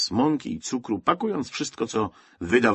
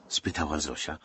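ordinary delivery

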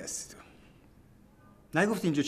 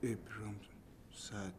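whispered name, much softer; wary whisper; 2 semitones lower, 17 dB quieter than usual. Acted. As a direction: hush it as if it is dangerous. (نجوای محتاطانه)

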